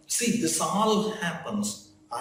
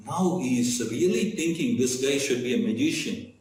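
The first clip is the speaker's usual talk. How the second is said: ordinary talk, nothing marked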